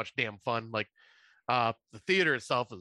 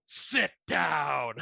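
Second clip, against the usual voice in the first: mock yell; 3 semitones higher than usual. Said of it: "Colin will yell".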